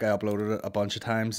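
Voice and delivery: ordinary talk, nothing marked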